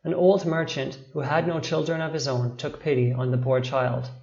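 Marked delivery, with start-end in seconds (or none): none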